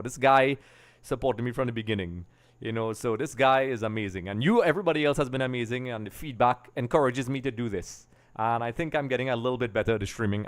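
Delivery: ordinary talk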